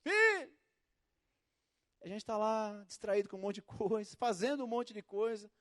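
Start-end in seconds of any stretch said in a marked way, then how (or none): 0.0-0.5 higher, louder gentle call; God calling. Retold or imitated. God calling his child, gentle raised call. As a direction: call out to a child you love. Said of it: "Chamando na primeira sílaba".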